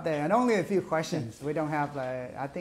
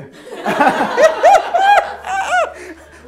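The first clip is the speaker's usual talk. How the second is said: loud high burst of laughter; big laugh; 14 dB louder than usual. Real, not acted. Class erupts in loud laughter at beer answer. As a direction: cackle loudly, helpless.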